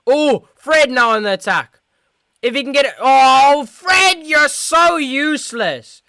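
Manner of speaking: high, loud live call turning to a drawn-out wail; excited call collapsing into loud frustration; exasperated shouted commentary. Real, not acted. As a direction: shout the attack, then berate the player. Live chance wasted by Fred.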